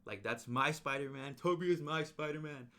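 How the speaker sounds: loud, high squeal of joy; fan excitement. Retold or imitated. Reliving the cinema moment, loud and overjoyed. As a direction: gush like a thrilled fan. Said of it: "I was like".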